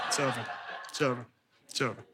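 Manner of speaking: a hint of mock despair; hopeless. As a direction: let it sink, almost gone. Acting despair after no reply.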